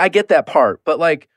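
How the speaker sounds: normal talking voice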